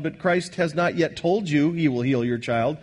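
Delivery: usual speaking voice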